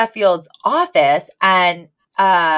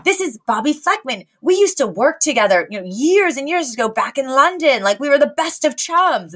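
chummy Mister Sheffield; 6 semitones higher than usual. Retold or imitated. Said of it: "Mister Sheffield's like".

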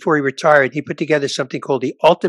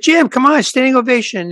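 pleading outburst; 10 semitones higher than usual. Retold or imitated. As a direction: push back hard, almost begging. Pleading with booker after great set.

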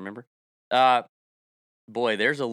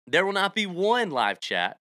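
ordinary talk

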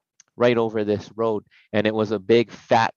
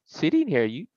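mock innocent; 6 semitones higher than usual. Protesting innocence to cops.

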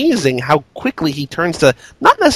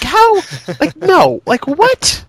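incredulous; 12 semitones higher, much slower than usual. Real, not acted. Reacting to the absurd high beams scene, incredulous. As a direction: squeak out the disbelief. Outraged disbelief at the film.

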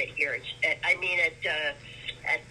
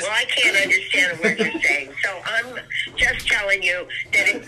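loud, straining to be heard; shouting into a speakerphone; yelling across the room; 8 dB louder than usual. Real, not acted. Mom on bad phone line.

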